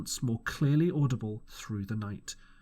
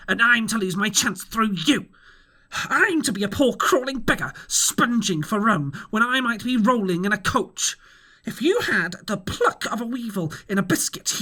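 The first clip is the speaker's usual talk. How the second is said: higher, louder ranting captain; raging blind pirate; 7 semitones higher, 4 dB louder than usual. A narrator's reading. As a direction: rail at the cowardly crew. Pew's ranting speech.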